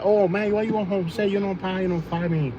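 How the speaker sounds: playful imitation of a Vietnamese accent, not full-on; mock Vietnamese accent. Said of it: "Vietnamese people do have the coolest accent".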